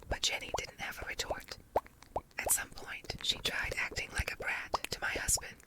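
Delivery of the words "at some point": ordinary delivery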